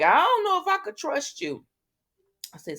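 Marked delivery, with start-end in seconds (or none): 0.0-1.6 squeaky high mock doubt; imitating a wary wife eyeing her husband, pitched way up; a high, hammed-up quote of a woman who can't trust her husband; doubtful look. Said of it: "She just looking at Martin like".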